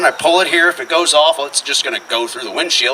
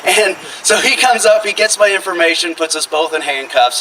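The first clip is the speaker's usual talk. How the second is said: normal talking voice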